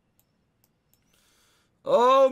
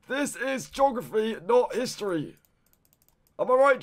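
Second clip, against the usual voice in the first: ordinary talk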